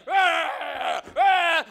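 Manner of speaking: very loud, high mock roar; gorilla roar. Costumed gorilla among screaming kids, loud roaring. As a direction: bellow like a rampaging gorilla. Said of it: "try to really be a big, bad gorilla".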